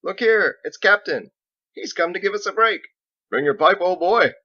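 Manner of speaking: loud, high hail from up on the wall; calling out. Acted. Masons calling down to Captain, loud and cheery. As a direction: shout a cheery greeting across the site. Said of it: "The masons saw him coming and called out".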